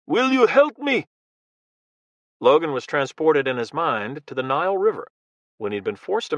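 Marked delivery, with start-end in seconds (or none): 0.1-1.0 put-on high, loud plea in a thick Russian accent. Russian man begging Logan for help, loud Russian-accented plea. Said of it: "In a thick accent, the man spoke loudly".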